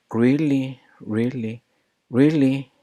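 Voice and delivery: "Really" con R fuerte y tono subido; emphasized really. Shown as a demonstration. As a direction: marca cada "really" con énfasis. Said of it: "Pay attention to that".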